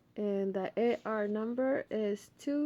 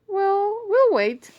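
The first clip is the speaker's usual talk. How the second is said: Sam's voice; 11 semitones higher, 11 dB louder, slower than usual. Acted. Sam voiced in story.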